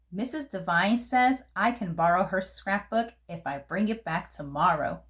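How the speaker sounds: ordinary delivery, nothing marked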